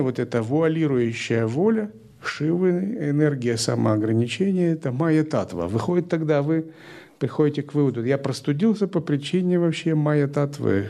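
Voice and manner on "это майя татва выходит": ordinary talk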